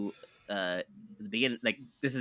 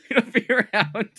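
high, loud, slow words through laughter; laughter bursting through a drawn-out echo; real laughing; 12 semitones higher, 9 dB louder than usual. Real, not acted. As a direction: crack up while repeating the phrase. Cracking up echoing the phrase.

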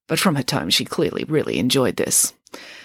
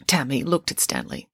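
plain reading voice

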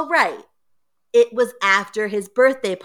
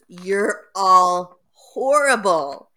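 slow, louder outraged cry; mock scream; 5 dB louder, much slower than usual. Boy yelling at laughing family, slow outrage. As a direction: wail it at the family, drawn out. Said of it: "And he screams".

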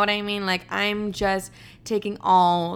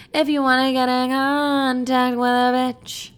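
a touch higher, in a campy character voice; sassy put-on voice; 4 semitones higher than usual. Host repeating her email line, now in a sassy put-on voice. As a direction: say it again as a sassy diva. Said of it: "repeat in a put-on voice".

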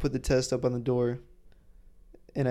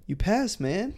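laid-back hippie with a laugh; a higher, easygoing hippie drawl; imitating the chill proctor; cool hippie guy; 8 semitones higher, slower than usual. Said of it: "this real cool, like, kinda, like, hippie kinda guy".

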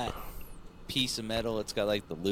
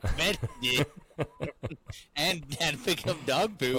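chuckling through the words, slowed; laughing; 5 semitones higher than usual. Real, not acted. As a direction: laugh it out while talking. Laughing at kid's punishment.

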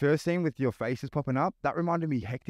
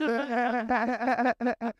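real laugh breaking out high; high burst of laughter; 11 semitones higher than usual. Real, not acted. Laughing at the pre-show note.

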